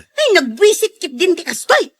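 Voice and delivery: loud, high, exasperated complaint; wife fed up with the traffic, griping loudly; annoyed outburst. Acted. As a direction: vent at the husband in frustration.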